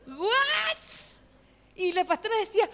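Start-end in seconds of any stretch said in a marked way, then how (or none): 0.1-0.8 high-pitched stretched squeal of disbelief; shocked what. Retold or imitated. Acts out shock at the prophecy.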